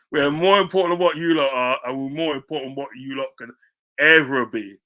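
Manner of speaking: loud, pushing on with the boast; gloating. Real, not acted. Rubbing it in to Spurs fans, loud gloating.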